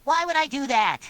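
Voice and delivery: high-pitched, irritated retort; annoyed. A narrator's reading. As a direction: push the pitch up, put out. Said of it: "answered back in an annoyed tone".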